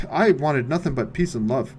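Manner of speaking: ordinary talk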